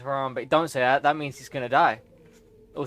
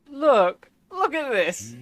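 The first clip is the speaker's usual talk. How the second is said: higher and near crying; tearful cry; 11 semitones higher, 5 dB louder, much slower than usual. Real, not acted. Player upset by the sad scene, near tears. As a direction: plead for others to see through tears.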